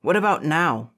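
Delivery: defiant challenge. A narrator's reading. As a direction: challenge him eagerly. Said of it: "his eyes catching fire".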